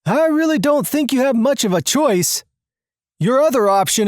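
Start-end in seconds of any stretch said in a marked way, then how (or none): 0.1-2.4 sarcastic. A narrator's reading. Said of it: "Victor looks around sarcastically".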